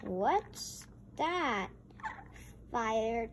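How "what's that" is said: usual speaking voice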